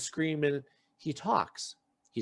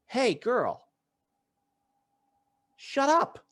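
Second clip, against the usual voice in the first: telling her to shut up; 8 semitones higher, much slower than usual. Retold or imitated. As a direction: snap at her to stop talking. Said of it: "the rest of the house goes".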